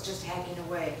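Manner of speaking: ordinary delivery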